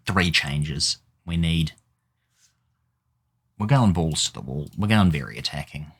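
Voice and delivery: normal talking voice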